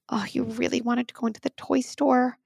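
put-on gently name the child's big wish; empathizing parent. Parent to three-year-old about the toy store, high tender retelling.

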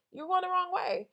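high, loud warning; emphatic warning. Real, not acted. Speaker warns the listener they're off course, high and loud. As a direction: call it out to turn someone around.

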